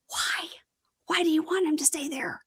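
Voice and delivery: soft incredulous whisper. Real, not acted. Podcaster whispering disbelief to her co-host about the couple. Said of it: "whispered aside".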